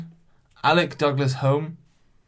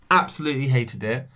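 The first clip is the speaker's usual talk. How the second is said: ordinary talk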